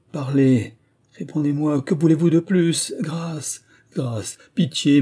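high, urgent begging for mercy; imploring plea, pitched well up; desperate pleading. A narrator's reading. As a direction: beg for your life, voice rising. Dying king begs monk for mercy.

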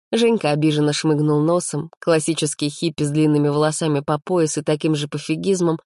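ordinary delivery

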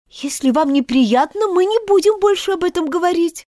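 put-on gently coax, a touch girlish; a hint of a polite little girl pleading; lightly voiced girl Alice.

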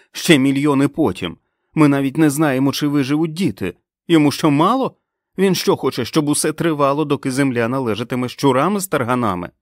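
loud, high tirade; angry shouting. A narrator's reading. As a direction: rail at her in outrage. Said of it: "гнівно викрикувала Френ". (гнівна тирада на підвищених тонах)

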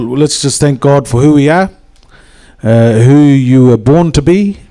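rising, earnest prayer voice. Acted. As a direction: lift the room into prayer. Leads a prayer.

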